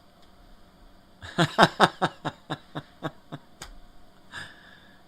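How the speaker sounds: loud breathy laughing fit; hearty laugh. Real, not acted. Owner laughing at the dogs, loud laughing fit. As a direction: let the laugh roll out loud.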